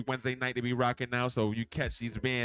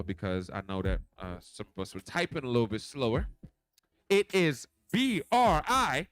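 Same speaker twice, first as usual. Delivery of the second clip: slowed down; 3 semitones higher than usual. Shown as a demonstration. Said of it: "I'ma slow it down for y'all".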